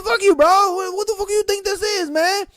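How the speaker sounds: high-pitched heated retort; mock quarrel. Cook answering an insult, mock angry high voice.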